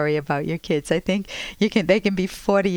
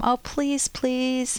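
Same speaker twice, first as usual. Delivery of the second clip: high-pitched wheedling plea; playful retold begging; mock pleading; 6 semitones higher, much slower than usual. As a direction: beg as if twisting his arm. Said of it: "tried to twist his arm".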